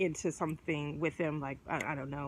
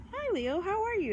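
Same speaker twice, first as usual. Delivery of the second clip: higher, overly friendly hello; cheery greeting; 13 semitones higher than usual. Retold or imitated. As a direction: greet the Leo with put-on sweetness, pitched up. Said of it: "until you go".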